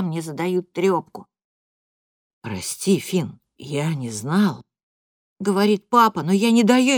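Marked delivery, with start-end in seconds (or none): 2.4-4.6 sorry, barely voiced; father apologizing to son, low and slow; ashamed father. A narrator's reading.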